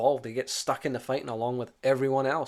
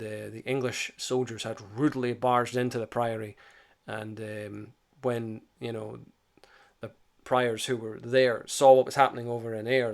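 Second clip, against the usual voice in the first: ordinary talk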